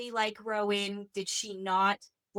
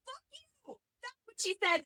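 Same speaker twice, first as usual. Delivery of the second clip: ordinary delivery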